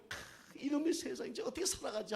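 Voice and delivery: high, put-on voice of a fretting newborn; breath of despair, then high-pitched mock complaint; newborn's mock lament. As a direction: wail it like a baby dreading life. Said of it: "반대로 얘기하면".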